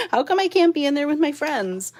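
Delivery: playfully put-on complaint as the dog, with a laugh; voicing the dog. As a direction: speak up high as the pet pleading to join in. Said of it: "She's probably like".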